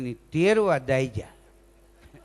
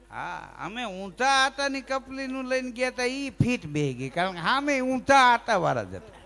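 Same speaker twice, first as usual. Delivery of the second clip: ordinary talk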